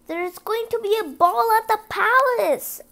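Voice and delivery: high, loud put-on stepmother voice; stepmother exclaiming. Stepmother reads out the ball invitation, high and loud. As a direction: announce it grandly in a character voice. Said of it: "her stepmother exclaimed".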